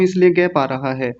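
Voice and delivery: normal talking voice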